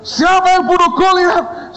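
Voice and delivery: high loud demand; booming question. Retold or imitated. Founder pressing the student again, loud and high.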